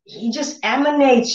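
normal talking voice

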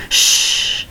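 unvoiced shushing; soothing shush to a hurt friend; soft hush. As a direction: hush him gently. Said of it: "Jimin hushes him".

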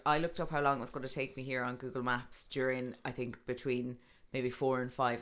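ordinary delivery

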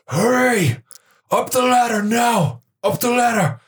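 high urgent yell; urgent shout. Acted. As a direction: shout them up the ladder. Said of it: "and they're like".

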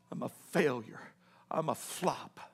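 very low, hushed despair; broken self-reproach. Acted. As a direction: barely voice it, as a defeated man. Peter alone after weeping.

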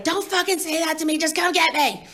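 snapping at her mom on the phone, impatient. Retold or imitated. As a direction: snap back at mom, impatient. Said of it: "And I was like".

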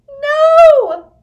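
long, very high, loud wail of protest. A narrator's reading. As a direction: cry out in anguish, high and piercing. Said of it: "wailed Blinky".

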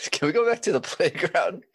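high-pitched question through laughter; laughing. Real, not acted. Co-host cracking up at the crude playground joke, laughing. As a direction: barely get the question out for laughing.

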